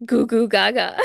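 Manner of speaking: put-on loud babbling baby voice; baby talk. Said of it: "he looks at me and he goes".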